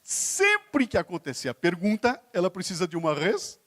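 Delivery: "Sempre" stretched out slowly, "res-" left hanging for completion; prompting question intonation. Shown as a demonstration. Speaker coaching the audience to answer, prompting intonation. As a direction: draw out "Sempre" and cut off at "res-" as a cue. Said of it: "eu posso mudar a minha entonação".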